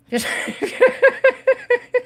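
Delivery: bright high burst of laughter. Real, not acted. Streamer laughing at the jack card, a high burst of laughter. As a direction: let a high laugh break out. Reacting to a card during reading.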